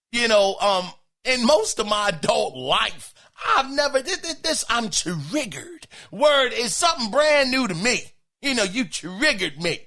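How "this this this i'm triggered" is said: ordinary talk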